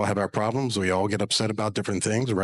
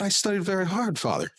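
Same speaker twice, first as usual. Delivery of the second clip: pleading daughter; 8 semitones higher than usual. Retold or imitated. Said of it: "And she was very angry".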